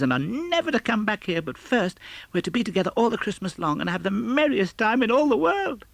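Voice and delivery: put-on high, bright-eyed child voice, eager and joyful; a small girl bursting with happy news; child's voice lifted well above the reader's own; little Fan. Fan continues her speech.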